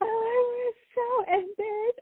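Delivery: very high, drawn-out squeal of laughing embarrassment; helpless laughing. Real, not acted. Admitting embarrassment to the listener, laughing helplessly. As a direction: squeeze the words out through real laughter.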